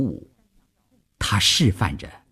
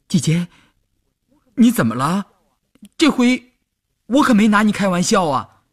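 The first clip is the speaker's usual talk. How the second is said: put-on startled worried boy; 11 semitones higher, 4 dB louder than usual. A narrator's reading. Boy startled by crying girl.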